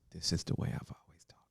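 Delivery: soft whispered confession; whispered aside. Real, not acted. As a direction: whisper it to the camera. Aside to camera.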